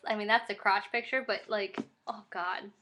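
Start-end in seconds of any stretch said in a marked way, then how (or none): none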